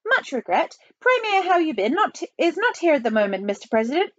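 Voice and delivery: put-on high, apologetic Chinese-accented voice; high official phone voice; Chinese assistant premier.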